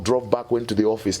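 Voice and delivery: ordinary talk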